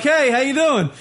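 high, oblivious hello; breezy phone greeting. Retold or imitated. Stoned guy phones his forgotten date, breezy and high-pitched. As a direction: imitate a carefree call to a girlfriend.

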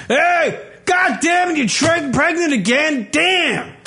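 high, shouted hillbilly yelling; hammed-up drunk trailer guy hollering at wife. Redneck husband voiced in Idiocracy scene.

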